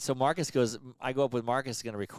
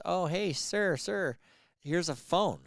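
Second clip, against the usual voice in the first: higher, sweet server voice; high polite waitress; 6 semitones higher, much slower than usual. Retold or imitated. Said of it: "comes over and says".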